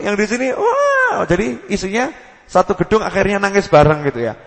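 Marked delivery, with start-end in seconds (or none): 0.5-1.2 high sudden cry, mock; retold wail. Preacher imitates worshippers crying out, high wail. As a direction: yelp it like a sob.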